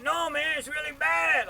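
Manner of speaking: high, very loud heckler's yell; mock a stranger's full-volume heckle; imitated yelling. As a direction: holler up at a painter on a ladder.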